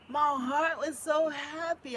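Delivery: loud, happy exclaim; joyful. Real, not acted. Mother delighted by daughter's gift, loud. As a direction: burst with joy. Joy over plants.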